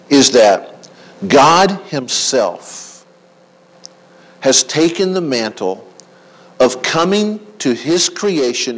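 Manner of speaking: loud slow proclamation; preaching at full force. Acted. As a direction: declare it slowly with full weight. Preaching climax.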